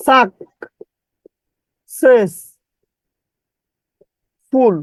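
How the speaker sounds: সাক, সেস, ফুল as separate chunks with long pauses; distorted spelling pronunciation. Shown as a demonstration. Teacher breaking a word for spelling, syllable by syllable. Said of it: "বিকৃত ভাবে উচ্চারণ করবেন".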